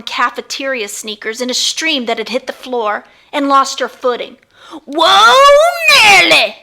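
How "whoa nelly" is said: high, loud slipping yelp; startled cry. Acted. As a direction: yelp it as your feet fly out. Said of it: "she cried".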